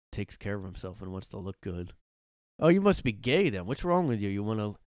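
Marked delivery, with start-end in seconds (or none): 2.6-4.7 mock accusing. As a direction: imitate a guy needling his buddy.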